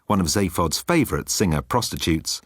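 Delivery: ordinary delivery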